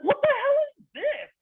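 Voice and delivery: very high squeaky shocked cry. Retold or imitated. Son shocked while changing dad's diaper, very high shriek. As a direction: shriek in stunned disbelief.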